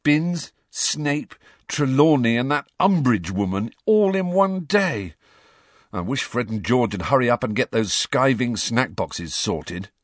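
high-pitched list of grievances; groaning complaint. A narrator's reading. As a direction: reel off each item in dismay. Ron groans over the timetable.

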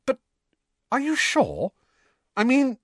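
high, halting disbelief; bewildered. A narrator's reading. Hermione doubting the news about Ron, bewildered. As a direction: falter in high-pitched confusion. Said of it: "looking thoroughly bewildered".

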